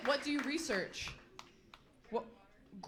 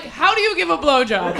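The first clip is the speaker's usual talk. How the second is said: mock loud question; 2 semitones higher, 11 dB louder than usual. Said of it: "I'm like".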